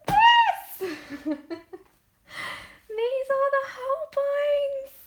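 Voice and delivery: high and loud on the word; excited reveal. Real, not acted. Showing the pencils to her viewers, excited squeal. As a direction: squeal it as you show them.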